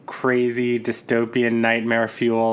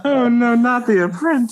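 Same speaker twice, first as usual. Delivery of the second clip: high, loud comic dismay; loud, high-pitched disbelieving groan; mock dismay; 11 semitones higher, 5 dB louder than usual. Real, not acted. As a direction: cry out in playful horror. Mock horror at the comparison.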